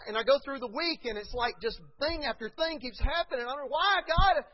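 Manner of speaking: high, loud anguished cry. Acted. Believer crying out to God, loud and anguished. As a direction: wail it to God. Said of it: "you're crying out like that".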